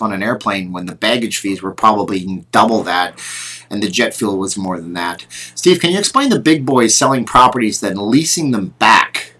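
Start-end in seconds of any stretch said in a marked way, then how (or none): none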